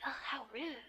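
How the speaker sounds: hushed, airy reproach; mock offended. Tutor voicing the girl's reply to rudeness, hushed mock offence. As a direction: half-whisper it as a mild scandalised aside. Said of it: "saying you're being rude".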